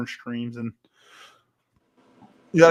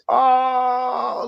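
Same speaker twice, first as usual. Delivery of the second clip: high, drawn-out ecstatic moan; mock a commentator swooning over a player; gushing, over-pleasured "oh"; Chris Collinsworth; 11 semitones higher, 3 dB louder than usual. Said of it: "like, fucking orgasm".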